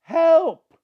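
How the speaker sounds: desperate high shout; full-throated cry for help; yelling for help. Retold or imitated. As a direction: scream it up the cliff for help. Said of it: "he's yelling at the top of his voice".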